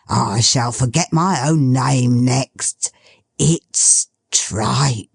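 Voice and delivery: put-on old father savouring the smell; old Toby. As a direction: sniff and savour like an old man.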